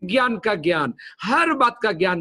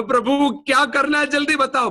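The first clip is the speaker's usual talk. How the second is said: hurried plea; 7 semitones higher than usual. Retold or imitated. Panicked plea inside shop.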